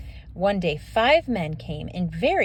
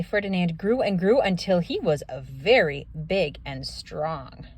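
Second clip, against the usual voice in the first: ordinary delivery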